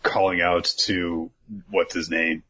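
normal talking voice

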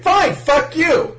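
high, loud, slow indignant snap; angry Chopper. Acted. Chopper fed up with the buried dude, high slow snap. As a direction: spit it out in a huff. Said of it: "And the Chopper's angry at this".

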